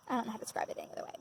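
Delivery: fast, whispered to herself; quick whispered afterthought; whispered aside. Real, not acted. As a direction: murmur it under your breath, hurried. Trails off after trauma talk.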